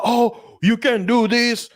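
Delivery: high-pitched mock objection; mocking French protest. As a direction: ham up a scandalised official. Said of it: "the French are like".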